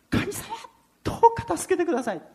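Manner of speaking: high, fast frantic plea to God; desperate prayer. Retold or imitated. Frightened driver silently begging God for help, high and fast. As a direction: beg God for rescue in a rush.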